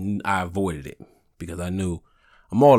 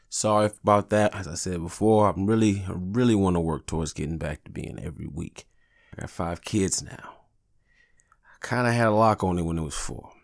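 usual speaking voice

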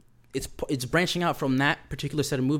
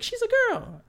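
imitating Tiffany in a very high falsetto; a shrill-high mock female voice insisting; Tiffany's girly voice. Tiffany insists kid is girl.

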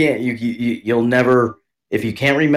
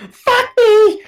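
mock moan; 5 dB louder than usual. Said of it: "And they're like".

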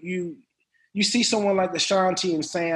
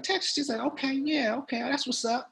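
high, softer imitation of a cool greeting; casual, raised-pitch impression of a woman; 11 semitones higher, 7 dB quieter than usual. Singer meeting a co-star for the first time, casual. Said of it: "And she was like".

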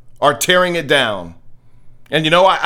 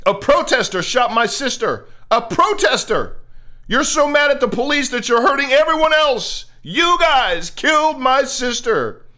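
retold outrage, pitched high; livid sister; 8 semitones higher than usual. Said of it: "Her sister was livid".

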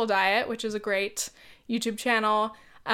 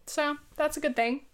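burping; 5 semitones higher than usual. Real, not acted. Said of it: "Burping again".

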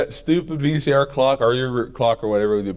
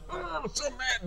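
mock grumpy sulk; 13 semitones higher, 9 dB quieter, slower than usual. Sulking in bed, mocked grumpiness.

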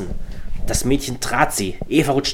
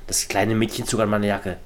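ordinary delivery

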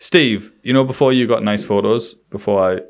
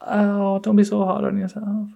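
higher, gentle consoling voice; mock soothing; 9 semitones higher, 4 dB quieter than usual. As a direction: console her sweetly. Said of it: "And I was like".

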